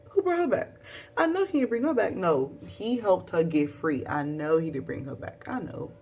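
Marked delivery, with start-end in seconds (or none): 0.1-2.1 high, rising worried voice; anxious hope. Retold or imitated. Viewer fearing the good guy betrayed her, anxious. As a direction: plead with the screen.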